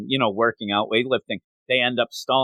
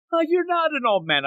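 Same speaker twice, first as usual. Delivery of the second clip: high protest; 14 semitones higher than usual. Retold or imitated.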